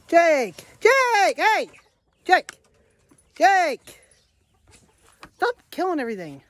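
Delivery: loud high shout for the dog. Real, not acted. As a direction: yell the dog's name across the yard.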